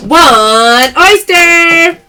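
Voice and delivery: very slow, loud, stretched call; slow loud showy callout; drawn-out announcement. Real, not acted. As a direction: announce the pick to viewers. Live sale callout.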